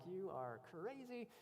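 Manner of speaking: slow, high, drawn-out mock disbelief; retold disbelief. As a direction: stretch it out slowly, as if to a crazy brother. Mock disbelief at his brother.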